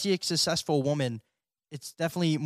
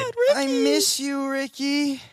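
put-on very high, breathy-free girly squeal of the ex; 9 semitones higher than usual. Podcast host mocking how the ex sounds, very high girly squeal. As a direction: squeal her name like a lovesick girl. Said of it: "I don't know if she talks like that".

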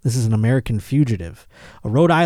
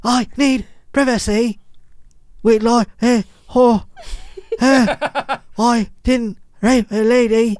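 put-on high, halting British accent with gasps; mock British invalid; 10 semitones higher, slower than usual. Mocking a fugitive gasping through his interview, hammed-up British accent. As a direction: struggle out each phrase in bad British. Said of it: "sounds like a Mike Myers character".

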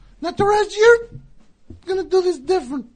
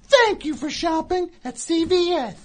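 over a PA system; 5 dB louder than usual. Shown as a demonstration. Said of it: "this is how you do it".

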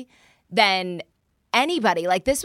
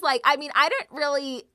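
ordinary delivery

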